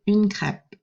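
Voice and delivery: une crêpe said the French way; French pronunciation. Shown as a demonstration. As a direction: model "une crêpe" for students to repeat. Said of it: "répétez".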